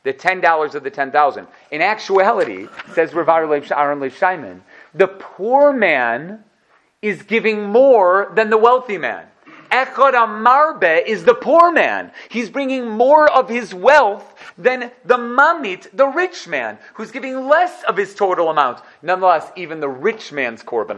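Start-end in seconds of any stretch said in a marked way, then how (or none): 4.9-15.9 slow, raised, emphatic build on the poor man; slow insistent emphasis on poor man. Real, not acted. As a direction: drive home that the poor give more. Emphatic point that poor man gives more.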